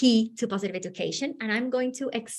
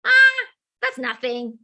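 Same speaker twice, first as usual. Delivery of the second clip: dismissive; 3 semitones higher, 6 dB louder than usual. Retold or imitated. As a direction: wave it away as nothing. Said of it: "for my sister was".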